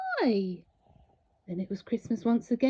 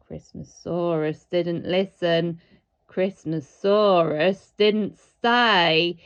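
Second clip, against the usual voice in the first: slow, louder, weighted lines; dramatic build; 5 semitones lower, 8 dB louder, slower than usual. A narrator's reading. Narrator slowing for the dinosaur's mistake. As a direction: lean on each word, slow it down.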